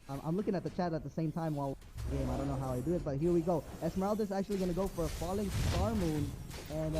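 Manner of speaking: normal talking voice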